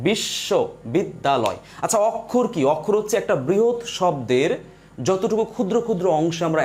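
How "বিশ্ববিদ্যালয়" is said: "বিশ্ববিদ্যালয়" stretched slowly into separate syllables. Shown as a demonstration. Teacher demonstrating how a word is pronounced, syllable by syllable. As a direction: draw out each syllable of "বিশ্ববিদ্যালয়". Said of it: "আচ্ছা, আবার বলতেছি".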